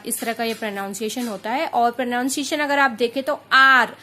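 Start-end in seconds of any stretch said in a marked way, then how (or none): none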